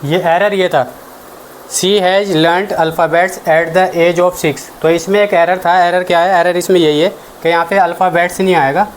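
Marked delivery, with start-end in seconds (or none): 1.7-4.6 dictation pace. Shown as a demonstration. Teacher dictates exam sentence.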